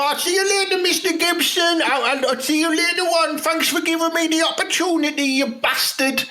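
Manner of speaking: high mocking farewell. Player mocks the boss as he leaves, high and sneering. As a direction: send the boss off in a squeaky sneer.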